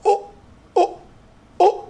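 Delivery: short high "oh" bursts with gaps; imitated twitching. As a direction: mimic involuntary jerks with little yelps. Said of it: "He starts twitching".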